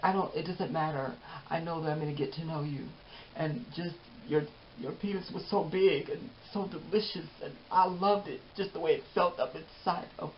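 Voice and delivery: lightly voiced lovestruck woman; imitating Melissa. Melissa gushing to Jeffrey, soft put-on voice.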